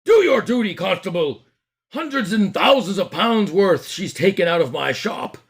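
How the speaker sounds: high, loud indignant shouting; outraged shopkeeper. Acted. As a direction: demand justice at full volume. Fat man demands arrest.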